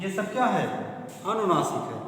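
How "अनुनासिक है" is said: ordinary talk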